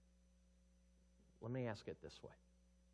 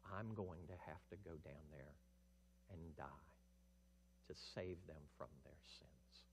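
hushed Jesus; 3 semitones lower, 6 dB quieter than usual. Acted. Jesus explaining to Father he must die, hushed. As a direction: confide the sacrifice quietly. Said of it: "Jesus says".

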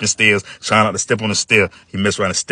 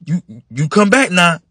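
higher, louder firm orders; stern big brother; 9 semitones higher, 3 dB louder than usual. Acted. Mike warning John to come back, firm and loud. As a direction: lay down the rules to the little brother.

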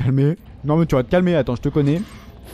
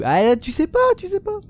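much higher, playful taunting; teasing sing-song; 14 semitones higher than usual. Pretend. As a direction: tease the viewers with a squeaky lilt. Teasing viewers again.

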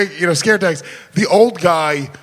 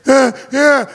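high, strained frightened answer; hammed-up panicky reply; mock scared victim; 7 semitones higher, 3 dB louder than usual. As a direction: imitate someone badly rattled. Acts scared victim answering the host.

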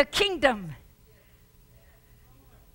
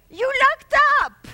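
mocking scoff; 11 semitones higher, 8 dB louder than usual. Doubter mocking jailed Paul.

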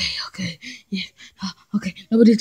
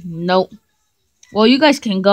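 ordinary talk

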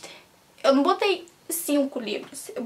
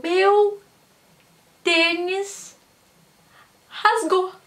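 higher, louder, drawn-out dismay; dismayed wail; 5 semitones higher, 4 dB louder, much slower than usual. Real, not acted. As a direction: lament the torn sneaker. Repeats with dismay. (lamento exagerado)

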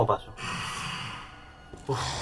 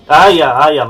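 ordinary delivery

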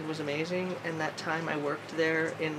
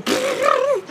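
high, loud burst of butterfly attack noise; swarming butterfly noise; 8 dB louder than usual. Acted. Vlogger imitating butterflies swarming someone, high loud noise. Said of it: "then they all just go".

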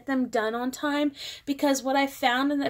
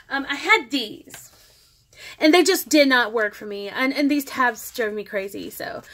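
ordinary delivery

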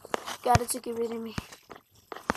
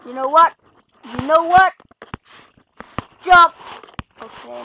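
usual speaking voice

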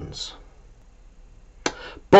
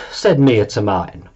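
usual speaking voice

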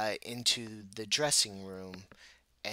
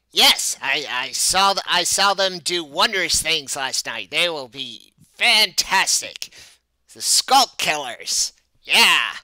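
loud, higher, theatrical promoter; excited show manager; 4 semitones higher, 13 dB louder than usual. Acted. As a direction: sell it big and excitedly.

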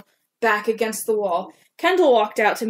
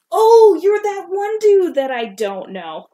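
high, loud mock-snooty comeback; sassy retort; 8 semitones higher, 7 dB louder, slower than usual. Girl sassing a boy who thinks she knows him. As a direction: put him down with exaggerated sass.

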